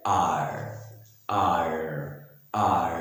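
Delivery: drawn-out American 'arrr'; American accent R. Shown as a demonstration. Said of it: "American से बोलते हैं".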